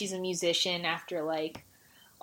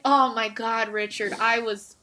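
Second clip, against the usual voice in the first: normal talking voice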